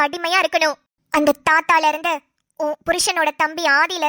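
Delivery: scheming friend Roja. Acted. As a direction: push her to go home now, low and insistent.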